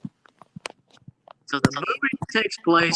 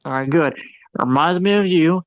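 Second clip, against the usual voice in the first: ordinary talk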